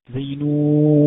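نون with the uu drawn out long and loud; letter madd demo. Shown as a demonstration. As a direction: hold the long vowel of نون like a reciter. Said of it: "مثال زي إيه؟".

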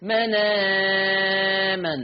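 "مناما" with a very long, steady aa; longest madd. Shown as a demonstration. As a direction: draw "manaaaaaman" right out on one held note. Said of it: "نقل مع ثلاثة البدل". ("مناما" بالنقل مع إشباع مد البدل)

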